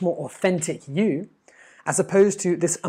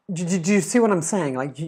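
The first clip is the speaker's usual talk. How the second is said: ordinary delivery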